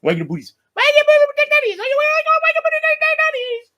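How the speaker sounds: put-on very high, loud squealing baby talk; high squeaky pet voice calling the dogs awake. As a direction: coo to wake the dogs.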